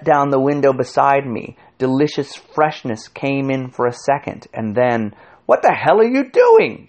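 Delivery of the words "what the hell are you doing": high, loud indignant shout; sudden outraged outburst. A narrator's reading. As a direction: yell it in alarm. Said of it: "shouted the intelligent man".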